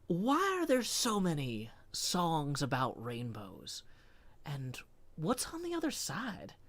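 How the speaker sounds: half-impression, halting dramatic spoken word. Shown as a demonstration. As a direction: recite song lyrics with swooping lines and long pauses. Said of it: "a combination of a [name] impression and my normal voice".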